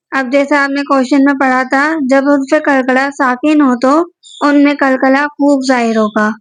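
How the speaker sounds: ordinary delivery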